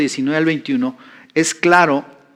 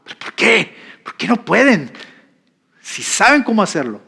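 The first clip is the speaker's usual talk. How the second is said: higher, exasperated retold scolding; annoyed pastor; 6 semitones higher than usual.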